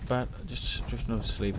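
usual speaking voice

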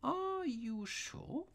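very high, slow timid question; timid eagerness. A narrator's reading. Little priest questions the gloating robber, timidly eager. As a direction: ask meekly, high and drawn out. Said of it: "with timid eagerness of the private secretary".

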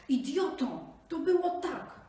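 high, loud furious snap; angry snarl. Acted. Tiger snarls at the jackal, loud and angry. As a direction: snarl at the fool. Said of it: "warknął tygrys".

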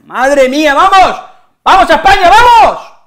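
very high, loud stadium cheer; cheering shout. Real, not acted. Fan urging Spain on, very high and loud. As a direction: yell it for the team.

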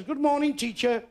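high sing-song classroom reply; pupils in chorus. Acted. Whole class answering the teacher, high sing-song. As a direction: chant it back like a class of children. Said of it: "They all says".

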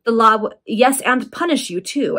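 plain reading voice

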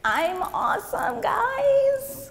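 high-pitched, drawn-out boastful mock yell; mock scream.

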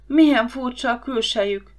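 ordinary delivery

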